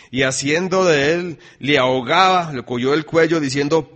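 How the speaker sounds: loud, ringing Bible reading to the church; scripture reading. Acted. Preacher reading Mateo aloud, loud and ringing. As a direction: proclaim the verses to the congregation.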